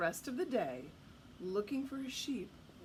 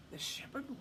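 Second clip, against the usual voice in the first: usual speaking voice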